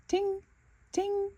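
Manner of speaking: high, light pinging; ting sounds. Acted. Bluey showing Calypso her shield, high light ting sounds. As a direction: flick each ting up high.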